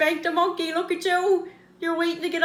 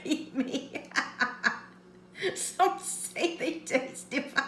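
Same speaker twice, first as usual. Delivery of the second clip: breathless laugh; 7 dB quieter, slower than usual. Real, not acted. Whispering through laughter.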